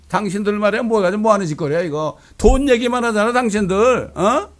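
loud, high-pitched furious scolding; imitating a teenager yelling at the UN; angry speech. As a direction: tear into the delegates like an outraged teen activist. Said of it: "유엔에 가서 연설하잖아요".

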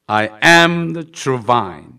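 very slow, loud, word by word; drawn-out preaching of "I am good shepherd"; slow intoned scripture. Acted. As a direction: lay each word down like a proclamation.

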